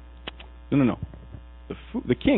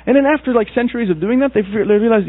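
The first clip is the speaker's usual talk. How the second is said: excited build; 10 semitones higher, 4 dB louder than usual. A narrator's reading. Preacher telling the congregation about the villagers, excited. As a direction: voice jumps up high and loud.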